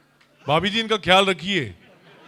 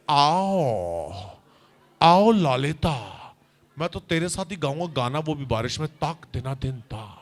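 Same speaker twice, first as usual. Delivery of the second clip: lower, sleazy crooning; Shakti Kapoor line; 4 semitones lower, 4 dB quieter than usual. Shown as a demonstration. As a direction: flirt with a cheesy villain purr. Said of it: "Look in the camera and just say". (नीची, चिपचिपी खलनायक आवाज़)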